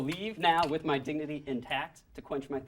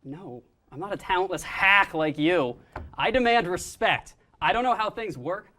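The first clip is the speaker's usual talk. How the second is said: angry demand; 5 semitones higher, 7 dB louder than usual. Acted. Diva tantrum over soda.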